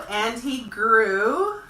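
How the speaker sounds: loud, slow storybook climax; big slow climax. A narrator's reading. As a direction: stretch it big as he grows huge. Story builds growth to climax.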